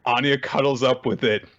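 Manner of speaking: usual speaking voice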